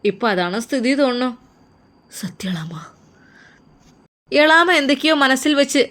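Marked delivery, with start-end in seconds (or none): 2.2-2.7 hushed, breathless confession; whisper. Acted. Navas whispering the truth to his aunt. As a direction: whisper it as if afraid to say it aloud.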